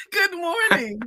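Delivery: high, loud, bubbly good morning; high, loud, laughing hello to the guest; excited greeting. Real, not acted.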